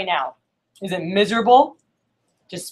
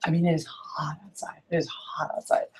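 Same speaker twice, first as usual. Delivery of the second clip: breathy whisper of complaint; whispered aside; 11 dB quieter than usual. Real, not acted. Muttering to herself about the heat, whispered. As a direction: whisper it under your breath. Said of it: "whispered aside".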